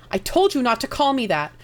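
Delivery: loud angry snap; angry outburst. Acted. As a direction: shout it in rage. Yun enraged at Luvjatar.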